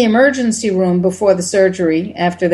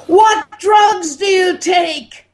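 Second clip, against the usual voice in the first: raised voice; 10 semitones higher, slower than usual. Retold or imitated. Doctor shouting slowly at an injured old patient. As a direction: shout each word slowly so the patient understands. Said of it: "So he raised his voice".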